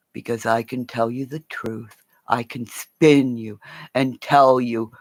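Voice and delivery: ordinary talk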